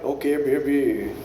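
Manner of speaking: low, slow put-on smooth talker; lightly voiced sleazy charmer, laughing; a hint of a slow creep line; mock smooth Indian guy. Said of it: "He's not like".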